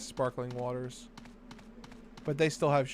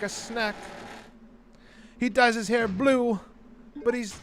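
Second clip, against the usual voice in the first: playing a cheeky British bloke, higher; British accent; 6 semitones higher than usual. Streamer to chat, jokey British accent. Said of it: "it's hard doing a British accent".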